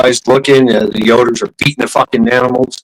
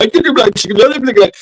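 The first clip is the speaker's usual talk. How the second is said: high-pitched gibberish mockery of a bossy man; talking down; 11 semitones higher, faster than usual. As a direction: imitate a boss talking down, pitched way up. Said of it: "talking, talking down to everybody".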